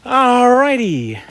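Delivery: high, very slow, stretched-out word; drawn-out exclamation. Real, not acted. Owner gets going on dog walk, drawn-out call. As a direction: stretch it out high, then fall. Setting off after fixing the ear.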